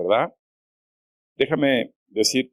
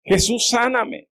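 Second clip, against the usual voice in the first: imitated desperate cry; 11 semitones higher than usual. Blind man begging Jesus to heal him, imitated desperate cry. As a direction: act out a desperate appeal for healing. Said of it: "si estaba gritando este hombre es porque tenía una desesperación".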